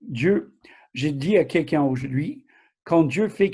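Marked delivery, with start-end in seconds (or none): none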